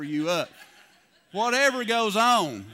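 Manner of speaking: bursting into loud laughter; laughing. Real, not acted. Joking about bad singing.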